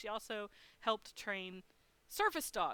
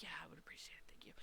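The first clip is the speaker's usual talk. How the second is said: breathy hushed whisper, much softer; whispered thanks; 6 semitones lower, 17 dB quieter, much faster than usual. Retold or imitated. Thanking the helpful guard, hushed whisper. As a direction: whisper your thanks, worn out. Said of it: "And I was like".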